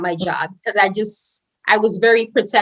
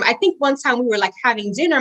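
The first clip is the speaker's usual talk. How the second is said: usual speaking voice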